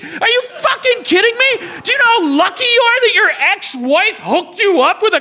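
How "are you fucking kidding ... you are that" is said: high, outraged cry; outraged rant. Real, not acted. Host ranting at Santa's ingratitude, outraged. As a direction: disbelief boiling over.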